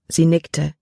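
plain reading voice

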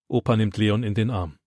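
usual speaking voice

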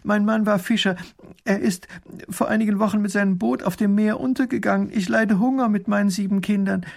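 high-pitched, halting tale of woe; desperate pleading. A narrator's reading. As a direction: plead desperately through the misfortune. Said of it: "ruft die Frau verzweifelt". (verzweifelt klagend, mit hoher Stimme)

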